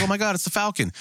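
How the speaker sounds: high-pitched fake amazement; mock awe. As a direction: imitate a starstruck crowd, sarcastically. Mocking everybody's awe at Falcon.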